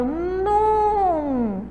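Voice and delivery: nun held in one long arc; drawn-out syllable. Shown as a demonstration. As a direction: stretch out "nun" as the strong syllable. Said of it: "A sílaba tônica é o". ("nun" bem alongado)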